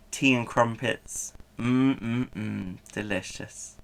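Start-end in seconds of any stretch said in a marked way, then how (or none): none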